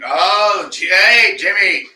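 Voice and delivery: loud, high shout across the room; loud hailing shout; calling out. Real, not acted. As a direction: holler his name to get his attention. Calling out to a friend.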